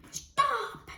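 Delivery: high-pitched yelp; yell. A narrator's reading. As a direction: yell it high, without much added volume. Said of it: "yelled Grandma Josephine".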